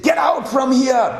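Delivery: high-pitched furious dismissal; shouted, high command; angry banishing shout. Acted. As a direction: throw someone out as an enraged king. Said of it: "so angry with Vidura".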